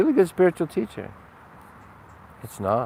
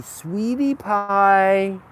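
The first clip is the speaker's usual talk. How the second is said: mock sweet; 7 semitones higher, much slower than usual. Mocking a too-soft Jesus image.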